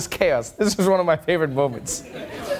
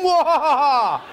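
loud evil cackle; evil villain laugh; 6 semitones higher, 3 dB louder, much slower than usual. Retold or imitated. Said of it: "what villains do".

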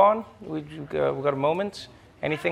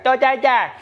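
put-on toddler babble; 9 semitones higher, 5 dB louder than usual. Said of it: "Then they'll be like".

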